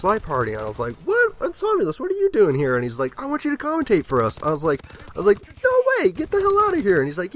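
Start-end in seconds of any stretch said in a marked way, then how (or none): 1.0-2.7 high, loud, squeaky surprise; mock shocked squeal. Gamer startled by friend joining his party, squeaky mock shock. Acting out shocked self in story. 3.2-4.4 high, quick, goofy voice for the friend; lightly voiced recruiter. Clan leader recruiting him, lightly voiced high and quick. 5.6-7.0 high, fast, loud disbelief; mock squeaky refusal. Gamer refusing the recruiter, squeaky and fast.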